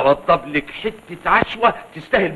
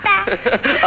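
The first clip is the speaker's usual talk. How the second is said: high chuckle; 8 semitones higher than usual. Acted. Grandpa laughs about his moustache.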